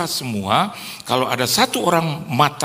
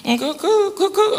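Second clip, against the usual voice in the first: straining voice. Retold or imitated. Girl strains failing high note.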